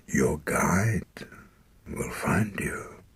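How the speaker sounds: slow, breathless whisper; dying whisper. Acted. Dying father tells his son his guide will find him, in a slow whisper. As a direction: whisper it with the last of his strength.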